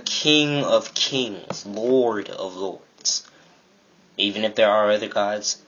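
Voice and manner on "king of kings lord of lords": very slow, drawn-out declaration; grand proclamation. Real, not acted. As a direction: stretch each title out with weight. Proclaims God's titles emphatically.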